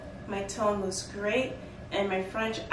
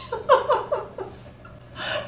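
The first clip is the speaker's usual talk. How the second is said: airy laughing fit, high; high breathless laugh; 4 dB louder than usual. Real, not acted. Laughing at the retold offer.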